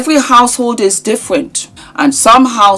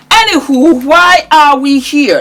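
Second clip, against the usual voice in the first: high playful; 7 semitones higher than usual. Acted. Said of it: "playful topic switch".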